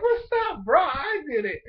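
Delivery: high, loud, overexcited dude yelling; excited shout. Retold or imitated. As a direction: shout it like a hyped bro showing off. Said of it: "The dude is just like".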